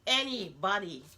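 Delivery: ordinary talk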